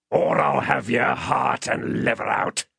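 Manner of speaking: rough, bloodthirsty threat; menacing man. Acted. Man threatening the child he tilts, menacing. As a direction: threaten the child with horrible relish.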